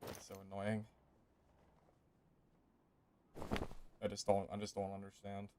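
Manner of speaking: barely audible sulking; quiet defeated mutter. Real, not acted. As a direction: mumble it to yourself, beaten. Defeated grumbling after the loss.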